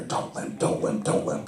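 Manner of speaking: urgent whispered mantra to yourself; whispered self-reminder. Retold or imitated. A wrestler walking to the ring, whispering to himself not to limp. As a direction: whisper it under your breath. Inner chant while walking out.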